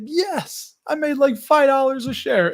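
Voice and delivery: high-pitched triumphant yes; excited retelling. Retold or imitated. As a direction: recount the win with rising excitement. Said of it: "I was like".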